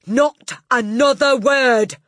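loud, high, drawn-out command; shouted. A narrator's reading. Headmistress silencing a teacher, shouting. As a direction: shout her into silence slowly. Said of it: "shouted Miss Trunchbull".

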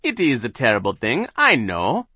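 high, loud villainess; Doctor Orwell. A narrator's reading. Hypnotist answers Violet's plea, loud villain voice. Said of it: "Doctor Orwell said".

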